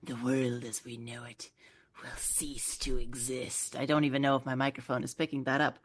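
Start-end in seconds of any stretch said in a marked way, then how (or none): 0.0-3.8 low whispered doom, slowing down; ominous whisper. Retold or imitated. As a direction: hush it like a prophecy. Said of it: "Gunko announces".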